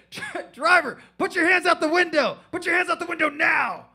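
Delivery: high, loud police command; cop megaphone shout. Retold or imitated. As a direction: yell orders like a cop on a loudspeaker. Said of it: "No, he said".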